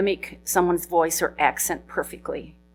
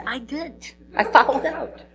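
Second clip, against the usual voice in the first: lightly voiced, higher cheeky admission; a hint of a proud goofball confessing; Randy's sheepish answer; 9 semitones higher than usual. Randy answering his coach, lightly voiced higher cheeky admission.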